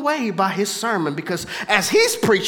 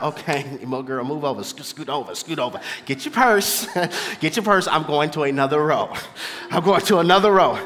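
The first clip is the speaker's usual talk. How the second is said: lower, hurried joking voice of a spooked pew neighbor; a hint of a scared churchgoer fleeing the pew; nervous churchgoer; 7 semitones lower than usual. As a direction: play the scared congregant bailing. Churchgoer flees the possessed neighbor.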